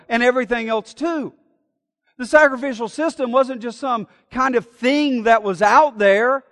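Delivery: fiery sermon climax; full-force preaching. Acted. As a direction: drive the point home from the pulpit.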